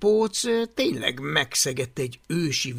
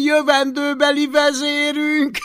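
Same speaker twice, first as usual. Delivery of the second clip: very high, loud gleeful jeer; mocking delight; 12 semitones higher, 8 dB louder than usual. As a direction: crow over the find. Gúnyos felkiáltás. (magas, harsány kárörvendés)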